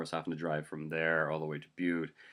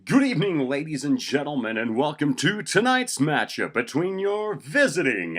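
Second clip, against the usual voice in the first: announcer voice; 9 semitones higher, 9 dB louder than usual. Acted. Announcer welcomes crowd to tonight's matchup, announcer voice. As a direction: boom out the game intro, stretching words. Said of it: "it's been my intro".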